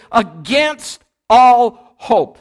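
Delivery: slow, loud, stretched-out preaching cry; each word hammered out from the pulpit; emphatic proclamation. Real, not acted. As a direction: drive the phrase home slowly and loudly. Preacher proclaims the key phrase.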